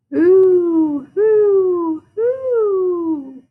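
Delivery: high loud hooting owl. Acted. Mister Owl hooting to Froggy at night. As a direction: hoot up at the moon. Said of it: "said Mister Owl".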